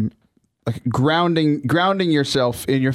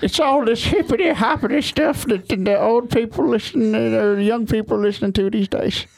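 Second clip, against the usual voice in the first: put-on high, old-timer grumble about kids; mock an old person griping about hip hop; Sam impression; 7 semitones higher than usual. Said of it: "impersonation of Sam".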